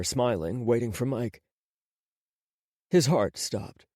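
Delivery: ordinary delivery, nothing marked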